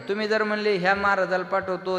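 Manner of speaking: loud, ringing kirtan sermon voice; a kirtankar thundering at the gathering; full-force preaching. Acted. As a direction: preach it out to the whole crowd.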